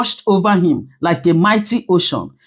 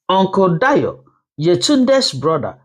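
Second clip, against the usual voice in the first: plain reading voice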